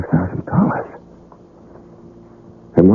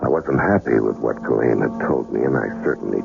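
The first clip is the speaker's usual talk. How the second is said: lower, steady voice-over narration; story narration; 6 semitones lower than usual. A narrator's reading. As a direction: tell it lower, like a film noir narrator.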